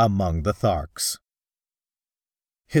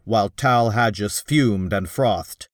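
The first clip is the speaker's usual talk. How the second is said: ordinary delivery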